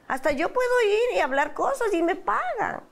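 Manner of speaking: high-pitched retold protest; indignant disbelief. Wife telling husband the program is a lie, indignant and high. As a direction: reject it incredulously, voice jumping high.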